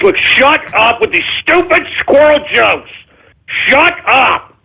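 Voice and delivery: high, exasperated telling-off; angry scolding. Real, not acted. Host to listeners about squirrel jokes, angry scolding.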